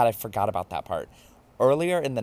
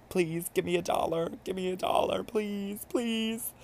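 high, drawn-out pleading whine; mock begging; 10 semitones higher than usual. Imitating drunk self pestering strangers for money, mock begging. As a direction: beg strangers in a hammed-up, pitched-up voice. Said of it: "a sick person".

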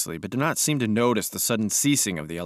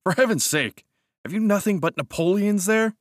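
exasperated; 11 semitones higher, 4 dB louder than usual. Acted. Cousin exasperated at books.

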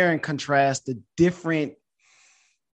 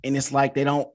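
ordinary talk

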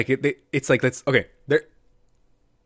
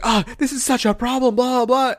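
high mock whine; mocking girlfriend; 9 semitones higher than usual. Mocking the TV girlfriend, higher and whiny. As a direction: parody her fussing. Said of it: "and she's like".

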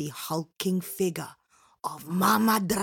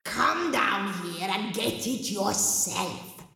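ogress voice; slower than usual. A narrator's reading. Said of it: "came the answer from the bottom of the well".

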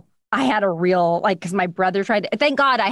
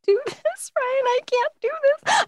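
high, drawn-out tearful wail; retold sobbing. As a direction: act out breaking down, pitch way up. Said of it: "like, sobbing".

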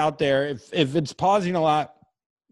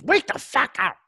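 mock baby yell; 10 semitones higher than usual. Baby screaming for parents at night, high yelling. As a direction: shriek wake up like a furious infant. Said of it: "That's all he's saying is".

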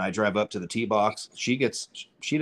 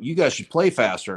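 louder, higher mock heckle; retold trash talk; 5 semitones higher, 6 dB louder than usual. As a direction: imitate a mouthy taunt, raised and louder. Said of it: "starts talking shit about".

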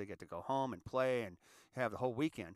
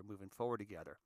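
usual speaking voice

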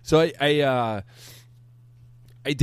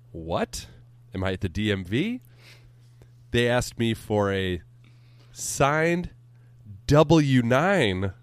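ordinary talk, nothing marked

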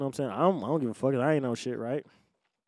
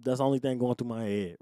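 ordinary talk, nothing marked

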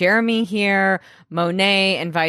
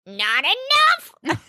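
imitating Cyrus; 5 semitones higher than usual. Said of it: "and he's like".

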